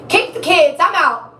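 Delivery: throwing up her hands, leaving it all behind, exclaimed; fed-up exclaim. Retold or imitated. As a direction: toss it off like you're walking out. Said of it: "I even said".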